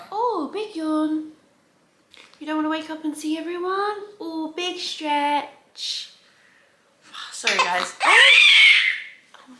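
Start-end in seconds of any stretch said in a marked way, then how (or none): 0.1-6.1 high, slow, drawn-out cooing. Real, not acted. Mother narrates her newborn's yawn to the baby, high slow coo. 8.0-9.5 piercing high surprised cry; sudden yelp. Real, not acted. Mother startled by her baby, sudden high shout.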